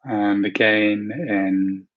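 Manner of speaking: calm, unhurried meditation voice with long pauses; guided meditation. Acted. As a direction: ease listeners gently into relaxation, leaving space to breathe. Leads a guided visualization.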